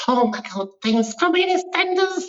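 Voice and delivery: very high, excited squeal of a thrilled mum; put-on mum voice. Mum excited that her son is on EastEnders, put-on high voice. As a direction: imitate mum in a silly high-pitched gush. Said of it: "why I did that voice".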